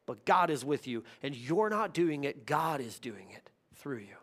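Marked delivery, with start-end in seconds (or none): 2.5-4.2 hushed, intimate whisper; preacher whispering encouragement to doubters; whispered reassurance. Real, not acted. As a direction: lean in and whisper the comfort.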